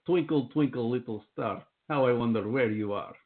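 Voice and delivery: nursery rhyme read in a heavy Russian accent; playful Russian accent on a children's rhyme; mock Russian accent. Shown as a demonstration. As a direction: make a kids' rhyme sound ominous with a Russian accent. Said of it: "you could even just do, like, a little kid song".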